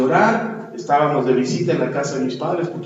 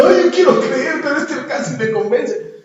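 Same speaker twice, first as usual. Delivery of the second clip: higher, lightly hammed-up whine with a laugh; mock whimper; 9 semitones higher than usual. Preacher imitating his younger self whining to his father, mock. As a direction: play up the crying complaint lightly. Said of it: "me fui a llorar diciendo".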